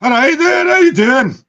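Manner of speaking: high, loud put-on Scottish greeting; Scottish accent. Shown as a demonstration. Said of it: "do us a Scottish accent".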